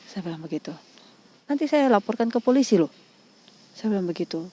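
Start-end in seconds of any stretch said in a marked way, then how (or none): 1.5-2.9 higher, louder re-enacted threat; retold warning. Woman threatens caller with the police, re-enacted indignation. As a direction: warn the caller with raised voice.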